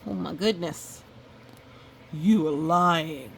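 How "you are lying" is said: wolf accusing. Acted. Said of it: "said Wolf".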